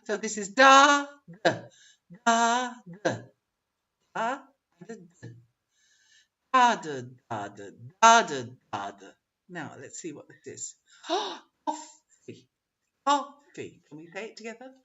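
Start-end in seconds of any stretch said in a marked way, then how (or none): 0.0-9.1 loud "da", then weak "da", alternating; rhythmic beat. Shown as a demonstration. Teacher leading the rhyme's rhythm, strong-weak stress. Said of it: "let's do this rhyme together". 11.1-13.8 "Coffee" high, stressed on "COF"; rhyme rhythm. Shown as a demonstration. Teacher modelling the rhyme word, first syllable stressed. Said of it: "let's do this rhyme together".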